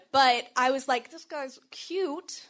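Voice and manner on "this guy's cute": inner thought. Retold or imitated. Private thought about her crush.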